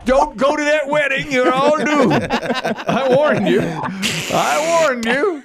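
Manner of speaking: high, slow doomsayer warning; slow ominous raspy drawl. Acted. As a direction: drag out the warning like a crazed prophet. Crystal Lake doomsayer warning.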